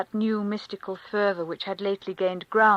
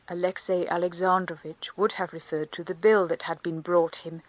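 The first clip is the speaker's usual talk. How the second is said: ordinary delivery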